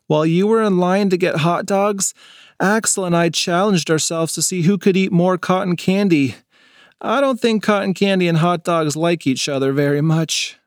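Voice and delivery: a boy with a sore tummy, lightly voiced; lightly voiced kid. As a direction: play the queasy kid after too much candy.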